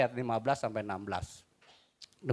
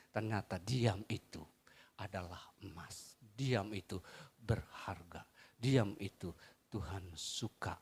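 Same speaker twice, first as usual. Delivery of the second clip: low and slow, almost a whisper; soft, hushed preaching; 5 semitones lower, 8 dB quieter than usual. Acted. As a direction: draw the church into stillness. (khotbah pelan dan lembut)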